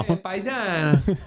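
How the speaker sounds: put-on slow hammy Italian accent. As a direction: drawl it like a mock Italian. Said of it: "I got accents for days".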